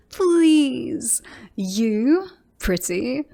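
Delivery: derisive, amused incredulity; mocking disbelief. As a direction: ridicule the rival's boast. Scoffs at rival's pickup line.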